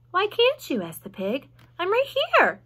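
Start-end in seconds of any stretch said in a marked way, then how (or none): 0.1-0.8 high-pitched, puzzled question; childlike, high query; eager piglet. A narrator's reading. Wilbur, puzzled, questions the spider. 1.8-2.6 high, loud protest; childlike, high exclamation; eager piglet. A narrator's reading. Wilbur insists he can be seen.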